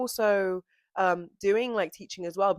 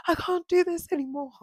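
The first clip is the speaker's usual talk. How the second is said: high, louder dramatic whine; hammed-up high-pitched exasperation; mock despair; 11 semitones higher, 5 dB louder than usual. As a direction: act out a bad-day meltdown, half joking. Acts out a bad-day despair.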